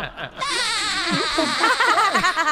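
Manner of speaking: high, long laughing wail; helpless laughter. Real, not acted. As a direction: laugh till it turns to a squeal. Laughs at the double meaning.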